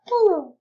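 sudden high, loud "woo"; high whoop. Real, not acted. Player reacts to the game, high excited whoop. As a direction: let out a short falsetto whoop.

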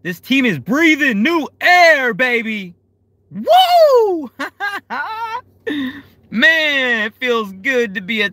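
high, loud, slow build into a whoop; cheering fan whooping for his team; elated cheer. Real, not acted. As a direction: let the joy burst out. Celebrating new stadium.

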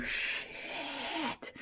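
breathless high whisper; whispered shock. Real, not acted. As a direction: gasp it out in shock. Real shocked reaction.

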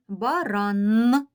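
Баран with a loud, held Н at the end; Баран-ннн, humming the Н; emphasized final Н. Shown as a demonstration. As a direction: lean on the last sound of Баран. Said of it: "Який же останній звук у цьому слові?".

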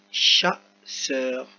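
slow "chasseur", syllables pulled apart; lecture articulée. Shown as a demonstration. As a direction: say "chasseur" slowly for young readers. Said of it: "donc on dit". (« chasseur » lentement, syllabe par syllabe)

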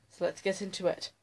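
ordinary delivery

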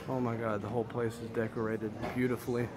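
ordinary talk

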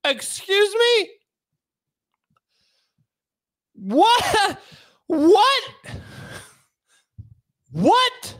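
high-pitched, hammed-up "what?"; shocked disbelief. Defendant meeting the public defender, high acted disbelief. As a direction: play stunned, each question rising out of a pause.

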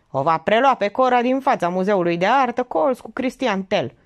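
high, emphatic briefing; cocksure informant. Acted. Young man details tomorrow's rendezvous, cocksure. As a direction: lay out the plan point by point, assured.

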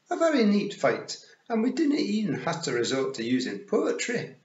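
high, loud Scots pictsie, satisfied; small boastful Scots warrior, pitched high; Rob Anybody. Acted. Rob boasting after a neat fight, high Scots pictsie voice.

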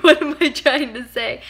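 loud, high mock bewilderment; amused retelling of someone lost for words; imitating confusion. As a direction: blurt it as a teasing quote, near laughter. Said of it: "And he's like".

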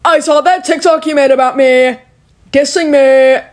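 lightly voiced teasing classmate; a hint of a guy from math class; mock classmate. Classmate teasing her about her TikTok, lightly mocking voice.

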